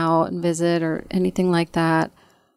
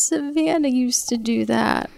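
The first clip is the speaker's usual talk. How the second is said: put-on slow and higher, quoting a sad little girl; a hint of a tearful daughter; lightly voiced young child explaining; child's voice; 6 semitones higher than usual. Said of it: "And she goes".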